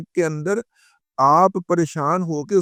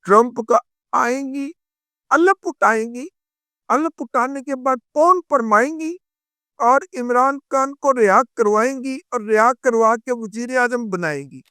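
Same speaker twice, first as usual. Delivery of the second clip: higher, sneering singsong mimicry; mocking singsong; 7 semitones higher than usual. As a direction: parrot their promises in a high mocking lilt. Mocking the other side's promises. (اونچی طنزیہ نقل)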